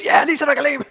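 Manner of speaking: high, laughing cheer of the crowd; crowd cheer imitation. Voicing the crowd in the movie scene, laughing cheer. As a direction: cheer it like the crowd while cracking up. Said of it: "And everybody goes".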